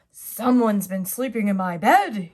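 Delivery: loud, grumbled bear voice; papa bear finding his bed rumpled; grumbling Daddy Bear. Acted. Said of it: "he grumbled".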